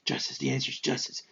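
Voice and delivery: low, hushed answer; whispered Batman. Acted. Batman answering the Riddler's riddle, low whisper. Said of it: "and he's like".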